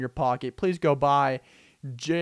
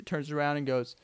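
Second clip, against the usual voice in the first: usual speaking voice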